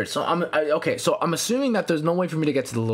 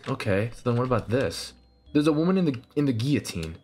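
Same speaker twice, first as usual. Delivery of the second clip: ordinary delivery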